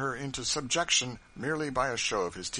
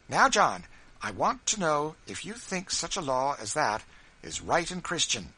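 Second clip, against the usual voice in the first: louder, higher, resolute demand; determined; 7 semitones higher, 6 dB louder than usual. Acted. Wife confronting her husband John, determined and louder. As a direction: plant your feet and demand an answer. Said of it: "said, in a determined tone".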